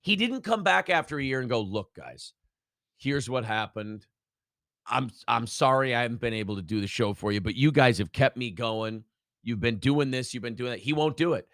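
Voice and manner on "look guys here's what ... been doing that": low, subdued voice of humble sincerity; imagined sincere apology. Retold or imitated. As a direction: model a heartfelt address to loyal fans.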